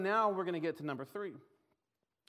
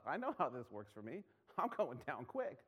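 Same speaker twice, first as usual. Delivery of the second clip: ordinary talk, nothing marked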